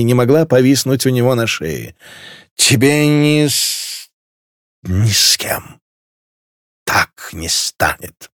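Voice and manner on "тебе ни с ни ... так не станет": halting, slow, sinking words; drunken faltering. A narrator's reading. Drunk girl mumbles her vow to marshal, faltering. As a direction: trail through it with tipsy stumbles.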